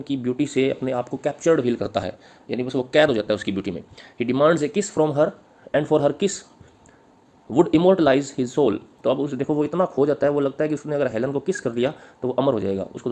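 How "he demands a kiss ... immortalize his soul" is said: slow read-out with long breaks between phrases; dictation. Acted. Teacher reading notes on Helen's kiss, slow dictation.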